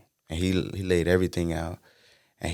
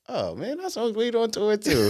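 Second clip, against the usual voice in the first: dad voice, playful. Retold or imitated. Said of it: "He was like".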